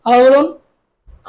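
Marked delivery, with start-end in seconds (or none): 1.3-1.3 খ thick and rasped at the back of the throat; a heavy, deep-throated খ; heavy kha. Shown as a demonstration. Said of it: "মোটা". (মোটা করে খ)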